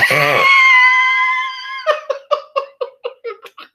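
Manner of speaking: high, loud burst of laughter; real helpless high-pitched laughing; shrieking laugh. Real, not acted. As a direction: crack up into a long, piercing cackle. Cracks up at own joke.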